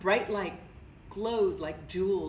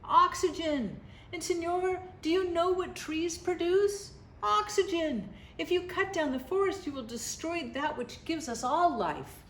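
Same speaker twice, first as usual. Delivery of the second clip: much higher, excited porcupine voices, far from a whisper; 9 semitones higher than usual. A narrator's reading. Porcupines urging the man, much higher excited squeal. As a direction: squeal it up high and urgent. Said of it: "whispered to the man".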